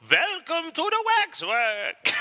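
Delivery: high, drawn-out mock trailer voice; Jonathan's trailer impression. Host imitates co-host's impression of the Waxwork trailer, hammed-up and drawn out. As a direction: stretch it out slowly in a put-on high voice. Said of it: "hear Jonathan repeatedly go".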